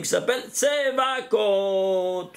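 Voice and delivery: "Tsevakot" drawn out slowly and reverently, stress on the last syllable; slow reverent name. Shown as a demonstration. Teacher slowly voicing the divine name with awe. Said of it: "je ne peux pas le prononcer".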